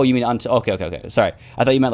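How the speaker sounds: ordinary talk, nothing marked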